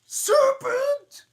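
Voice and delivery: screeching high scream of a bird; 비둘기처럼 새된 비명; shrieking pigeon. Acted. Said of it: "gotta do a Williams voice".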